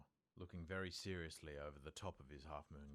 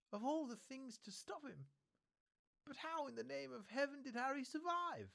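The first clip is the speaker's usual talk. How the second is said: put-on high, stern old witch voice, upset; a shaken elderly teacher near tears; McGonagall, distressed; 4 dB louder than usual. A narrator's reading. McGonagall tells Dumbledore of the Potters, high and distressed.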